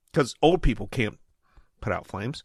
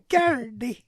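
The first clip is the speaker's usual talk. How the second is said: mock leprechaun; 9 semitones higher than usual. Joking leprechaun voice.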